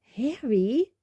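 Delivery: high, loud shocked exclamation. A narrator's reading. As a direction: say the name in shocked disbelief. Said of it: "sounding shocked".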